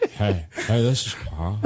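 put-on friend impression built on the hey; lightly mocking buddy imitation; Carl. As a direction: lean on the greeting as a buddy would. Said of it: "It's all about the".

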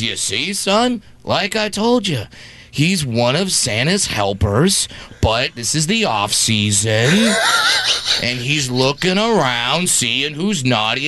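low, slow fatherly storytelling voice; explaining dad. Retold or imitated. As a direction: spell it out slowly for a small son. Voicing a father explaining to son.

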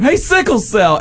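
high yelled call across the room; mock yell of a nickname across a crowded place. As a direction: shout it out to the crowd. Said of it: "they just scream".

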